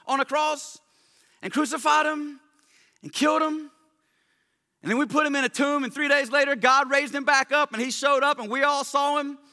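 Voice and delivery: high-pitched Southern preacher holler; Peter, Southern paraphrase. Retold or imitated. Peter preaching to those who killed Jesus, insistent Southern holler. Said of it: "[name] Southern paraphrase of Peter's sermon".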